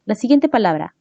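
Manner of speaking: ordinary talk, nothing marked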